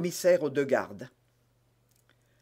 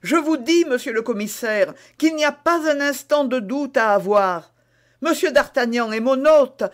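animated, higher-pitched protest; exclamation insistante; 8 semitones higher, 7 dB louder than usual. A narrator's reading. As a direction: argue insistently, punching the key phrases. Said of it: "s'écria à son tour monsieur Bonacieux". (protestation vive et insistante)

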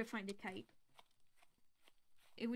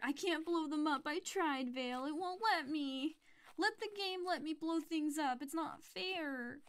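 higher, louder whine, slowing down; whiny complaint; 5 semitones higher, 3 dB louder than usual. Real, not acted. Whining game won't allow it.

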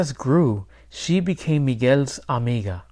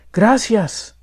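higher, louder cry of a name; alarmed call; 8 semitones higher, 5 dB louder than usual. A narrator's reading. Boy jumps up at a noise outside, calling out. Boy calls his stolen turkey.

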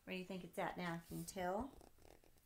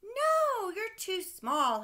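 loud high no, then slower; father crying out; 12 semitones higher, 13 dB louder, much slower than usual. Acted. Dad crying out to Sam as he flies off, loud refusal. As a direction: cry out the refusal. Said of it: "his father cried".

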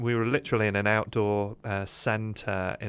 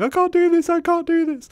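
a much higher, louder, frantic plea; panicked I can't do this; 10 dB louder than usual. Retold or imitated. Scared child clutching a pole on the beam, frantic panicked repeat. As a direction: cry it out like a terrified kid frozen on a high beam.

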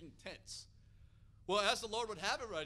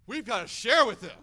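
imitating an insistent wife, much louder; urgent insistence; 3 semitones higher, 17 dB louder than usual. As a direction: push him into it, forcefully acted. Wife urges him, retold.

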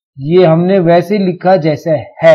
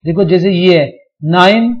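ordinary delivery

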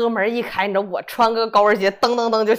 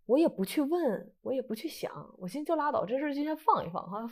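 softer, letting the words drop away; quiet, brushing it off; 2 semitones higher, 8 dB quieter than usual. Real, not acted. To herself, pushing away the calling, dropping to a soft aside. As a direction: mutter it like shelving an unwanted thought.

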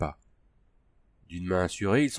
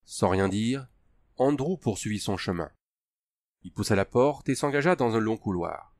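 plain reading voice

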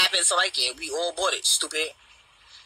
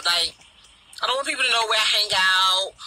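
ordinary delivery

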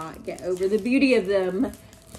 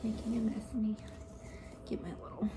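whisper; 3 semitones higher, 8 dB quieter, much faster than usual. Real, not acted. Mumbling while potting.

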